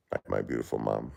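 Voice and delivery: drowsy, drifting off to sleep; half asleep, words sinking low; nodding off. Real, not acted. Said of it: "'cause I'm falling asleep".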